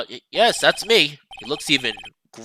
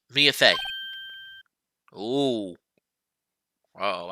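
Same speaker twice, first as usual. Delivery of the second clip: ordinary talk, nothing marked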